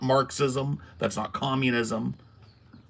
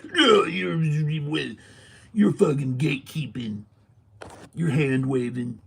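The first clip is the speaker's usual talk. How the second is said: slightly higher, whiny mock of a defensive critic; mock defensive opponent; 4 semitones higher, slower than usual. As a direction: parody someone accusing you of gatekeeping. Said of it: "I will ask them".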